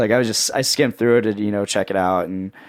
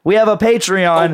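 louder repeat; 8 semitones higher, 6 dB louder than usual. Pretend. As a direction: announce it louder.

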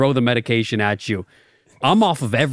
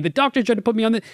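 high, fast mimicry of a friend; a light mocking high voice; mocking whine; 9 semitones higher, faster than usual. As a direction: quote him like a whiny kid. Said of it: "You're saying".

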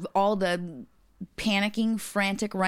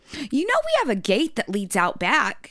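neighbor voice; 5 semitones higher than usual. Retold or imitated. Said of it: "She goes".

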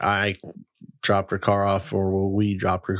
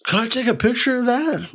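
excited fan; 13 semitones higher than usual. Retold or imitated. Excited guy quoted.

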